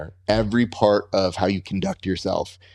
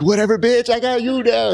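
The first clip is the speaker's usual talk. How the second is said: playful taunt pitched much higher; mock taunt; 11 semitones higher than usual. Imagining grappling the fighter later: high mock taunt. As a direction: tease him with a high mock jeer. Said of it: "I'm a hundred percent gonna be like".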